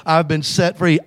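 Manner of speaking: ordinary talk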